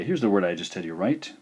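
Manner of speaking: ordinary talk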